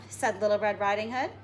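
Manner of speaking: plain reading voice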